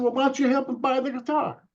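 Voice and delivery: very high, quick imitated seller; pleading shopkeeper. Seller appealing to the boy's uncle, urging imitation. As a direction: mimic the vendor, pitched right up.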